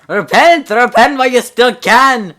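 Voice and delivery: high loud ranting yell; old man yelling. Acted. Retake: old man shouting at the teens, loud rant. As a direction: bellow like a street prophet. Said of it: "He starts yelling at us".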